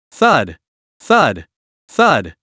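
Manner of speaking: low dull impact thuds; heavy thuds. A narrator's reading. Rocks strike the glowing barrier, low thuds. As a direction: thud like rocks hitting a shield. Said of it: "The rocks struck".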